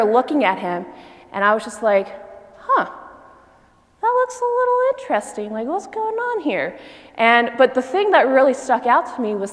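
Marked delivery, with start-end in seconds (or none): 2.6-6.8 curious. Retold or imitated. Curious at brother.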